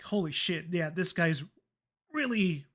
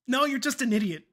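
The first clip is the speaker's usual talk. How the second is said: exasperated retort; 4 semitones higher, 6 dB louder than usual. Retold or imitated. Retelling telling off a customer.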